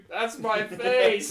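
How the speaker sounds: loud, slow high-pitched yell; drawn-out shriek of pain and surprise; screamed. Retold or imitated. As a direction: scream it out in shock. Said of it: "and I just scream".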